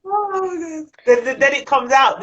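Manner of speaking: high, laughing exclamation; laughing in delight at the story. Real, not acted. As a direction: crack up while talking. Laughing at friend's accent.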